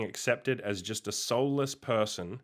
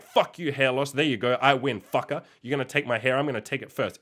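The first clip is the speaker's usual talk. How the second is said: retold angry yelling; 3 semitones higher, 5 dB louder than usual. Man shaving his head, telling off hair loss angrily. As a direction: re-enact a triumphant angry rant. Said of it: "I was, like, so angry".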